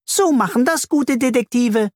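very high, eager little voice; perky high-pitched storybook voice; bee character. A narrator's reading.

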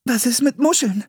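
put-on high girl's voice, shivering; teeth chattering. A narrator's reading. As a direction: speak through chattering teeth. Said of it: "sagte Anne mit klappernden Zähnen".

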